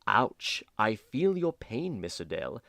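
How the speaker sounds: ordinary talk, nothing marked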